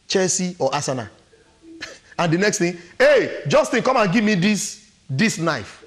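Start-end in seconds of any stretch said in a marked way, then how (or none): 3.0-5.8 raised voice, calling out to someone; husband hollering for something; demanding husband. Acted. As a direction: call out an order, louder and higher, easing at the end.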